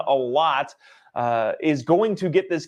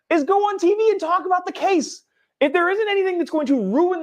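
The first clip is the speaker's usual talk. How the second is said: outraged disbelief, much higher; exasperated high pitch; 14 semitones higher than usual. Real, not acted. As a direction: voice jumps way up in disbelief. Ranting about juror on TV.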